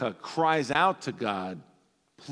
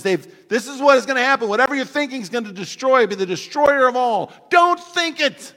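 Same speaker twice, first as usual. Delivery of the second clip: much louder, higher hammed-up movie warning; Bill Murray; 10 semitones higher, 12 dB louder than usual. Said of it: "Bill Murray looks at them all and he says".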